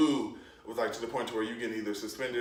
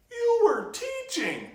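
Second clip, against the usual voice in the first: much higher, slow, drawn-out squeal of disbelief; exasperated protest; 5 dB louder, much slower than usual. Retold or imitated. Kid protesting to teacher, high, slow and exasperated. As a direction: stretch it out high like you can't believe her.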